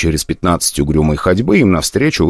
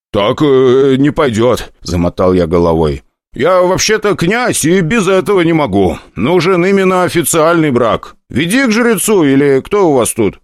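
put-on higher, insistent young prince voice; prince's protest; 9 semitones higher than usual. Prince refusing the hostess, insistent and higher. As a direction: object firmly, a bit higher.

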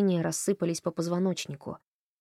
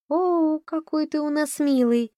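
much higher, slow tender coo; 9 semitones higher, 3 dB louder, slower than usual. A narrator's reading. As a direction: coo over the boy like a baby. Woman coos over the boy.

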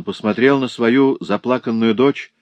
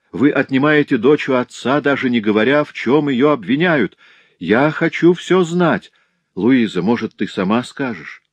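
raised pleading father, higher and louder; distressed demand; 6 semitones higher, 3 dB louder than usual. A narrator's reading. Father Morel demanding the charge against his daughter, anguished and raised. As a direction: press the commissioner hard, voice climbing.

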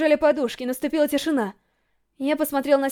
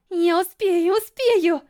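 higher, insistent inner cry; urgent self-urging; 3 semitones higher, much slower than usual. A narrator's reading. Racer urging herself to make it, urgent. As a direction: push yourself on, pitch climbing.

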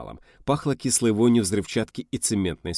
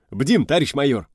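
high, loud chipper soldier's reply; peppy, raised military answer; brisk report; 7 semitones higher, 7 dB louder than usual. Acted. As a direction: report cheerfully to your major. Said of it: "бодро доложил Змей".

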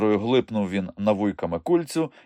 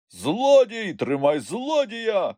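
high-pitched, drawn-out cry; chasing shout; 12 semitones higher, much slower than usual. A narrator's reading. Pursuers yelling after a thief, high shout. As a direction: call out for help catching someone. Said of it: "кричали задні".

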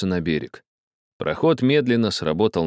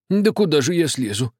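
high, mild surprise; surprised; 8 semitones higher than usual. A narrator's reading. Old man surprised at the captain. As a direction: sound taken aback. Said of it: "удивился старик".

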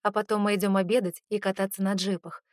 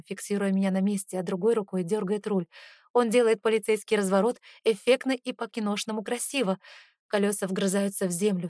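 ordinary delivery

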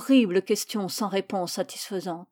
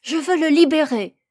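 loud, high-pitched avowal; child standing up to a father; brave declaration; 6 semitones higher, 7 dB louder than usual. A narrator's reading. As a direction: summon courage and declare it. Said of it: "trouva le courage de déclarer".

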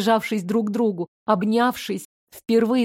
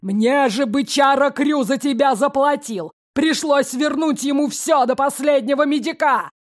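angry yell; 4 semitones higher than usual. Acted. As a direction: roar it in rage. Father yelling at daughter.

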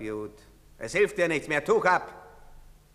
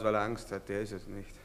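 ordinary talk

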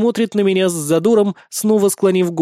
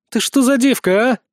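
higher, mildly hostile challenge; hissed; 7 semitones higher, 3 dB louder than usual. A narrator's reading. Captive wife challenging an unknown girl, mildly hissed. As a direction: challenge a rival with a touch of venom. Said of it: "прошипела моя жена".

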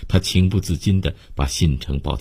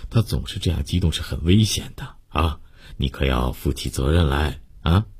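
concerned doctor, consoling; 5 dB quieter than usual. Acted. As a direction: gently chide and soothe, with little murmured interjections. Voices the doctor comforting patient. (医生轻声劝慰，略带责备)

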